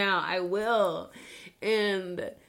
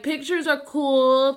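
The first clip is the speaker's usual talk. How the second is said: ordinary delivery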